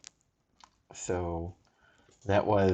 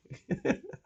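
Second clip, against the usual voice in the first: short laugh; 6 dB quieter than usual. Real, not acted. Laughs at a duped Fury.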